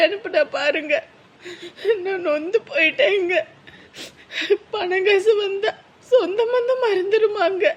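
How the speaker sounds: a wife sobbing to her husband about her sister. Acted. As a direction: let the hurt spill out crying. Wife sobs over sister's betrayal.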